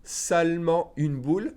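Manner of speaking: "seulement" with a silent E and a nasal "en"; careful pronunciation; careful French. Shown as a demonstration. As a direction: say "seul-ment" with the nasal ending. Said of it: "you pronounce the E N, so en".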